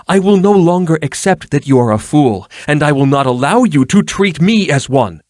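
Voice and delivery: high, loud indignant reproach; violent anger. A narrator's reading. Erlin confronting Danilo over playing the fool, angrily. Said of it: "said Erlin violently".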